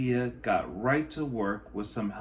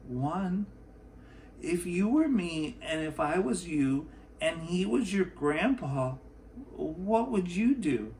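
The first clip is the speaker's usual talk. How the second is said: put-on higher, light child voice asking earnestly; a second-grader posing her question; Sofia the girl; 6 semitones higher than usual. Sofia asking the clerk what he would do, in a higher child voice.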